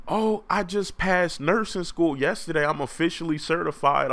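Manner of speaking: high, chirpy mock lady voice; high lady voice, proud of her diploma; eager nurse lady. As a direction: brag it in a bright squeaky voice. Lady says she just passed nursing.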